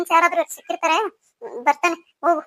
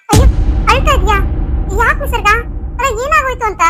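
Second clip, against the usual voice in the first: high, loud worried questions; raised voice, urgent concern; alarmed concern; 5 semitones higher, 6 dB louder than usual. Acted. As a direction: react with alarm to someone crying. Finds her in tears.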